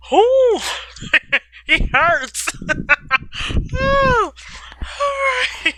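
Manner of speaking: high-pitched giggling fit; can't stop laughing. Real, not acted. Reader cracks up laughing.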